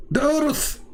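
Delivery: high-pitched cry of realisation; shocked exclamation. Pretend. Man realising he forgot to draw the earth, shocked exclamation. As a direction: blurt it high, as if suddenly remembering.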